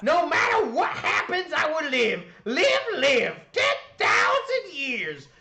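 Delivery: high, loud hollering revival preacher; high-strung evangelist. Pretend. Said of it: "the high-strung evangelist voice from Neverwinter Nights".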